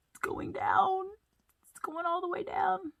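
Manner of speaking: high-pitched, playful worry; mock dismay. Pastor showing children the draining tank, mock dismay. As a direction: fret over it in a high little voice.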